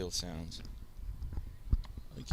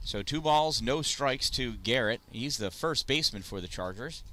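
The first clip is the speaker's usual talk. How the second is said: normal talking voice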